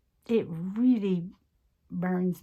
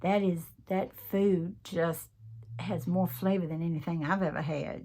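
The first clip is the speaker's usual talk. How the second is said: ordinary talk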